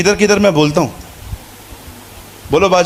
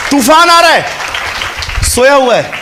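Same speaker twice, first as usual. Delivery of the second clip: preaching; 7 semitones higher than usual. Real, not acted. Preaching at full force.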